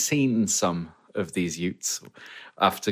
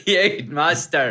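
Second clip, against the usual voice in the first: Ute Muster slowly, higher, laughing, in a passable Australian accent; 5 semitones higher, 3 dB louder, much slower than usual. Shown as a demonstration. Second try on the game show, [name] lands a laughing Australian accent. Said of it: "He got an accent right".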